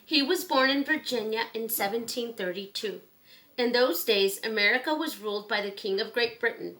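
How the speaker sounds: clear, gentle storybook read-aloud; reading to children. Acted. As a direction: read it like bedtime story time for kids. Reads picture book to children.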